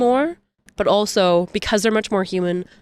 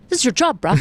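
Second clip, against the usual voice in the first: higher-pitched incredulous complaint; retold exasperation; 4 semitones higher than usual. Caller retelling a row with customer service, exasperated. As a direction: push back at useless staff.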